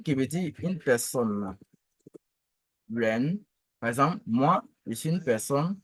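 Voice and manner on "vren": "vren" gliding upward; rising model "vren"; second tone. Shown as a demonstration. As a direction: start "vren" low and let it climb. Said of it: "le deuxième ton".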